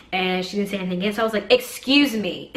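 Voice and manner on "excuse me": pointed, raised 'excuse me'; emphatic insistence. Retold or imitated. Mom's last try at the woman's back, emphatic. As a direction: demand attention after being ignored. Third try after being ignored.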